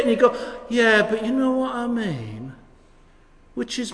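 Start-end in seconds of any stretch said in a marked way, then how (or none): 0.7-2.5 mock passive-aggressive comeback, pitched high. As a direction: imitate someone pointedly dodging the question. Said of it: "that sort of passive-aggressive thing".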